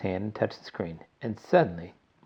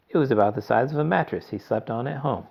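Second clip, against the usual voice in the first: plain reading voice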